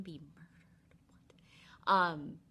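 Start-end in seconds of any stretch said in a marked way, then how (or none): 0.0-0.9 whispered aside. Retold or imitated. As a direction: drop to a sly whisper. Delilah's sly aside.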